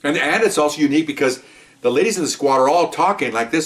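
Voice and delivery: ordinary talk, nothing marked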